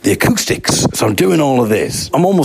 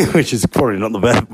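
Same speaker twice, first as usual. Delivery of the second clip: plain reading voice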